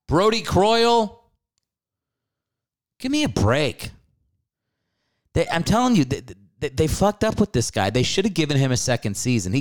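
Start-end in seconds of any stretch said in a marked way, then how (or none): 0.1-3.9 high, slow scoffing disbelief; can't believe that name; incredulous. Real, not acted. Host scoffing at the backup pick, incredulous.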